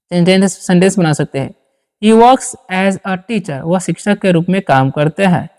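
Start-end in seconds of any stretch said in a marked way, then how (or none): 2.0-3.6 each word spaced out slowly for students; slow dictation. Shown as a demonstration. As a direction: read it slowly so the class can follow. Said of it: "इस तरह से बोल सकते हैं". (धीमे-धीमे बोलकर लिखवाना)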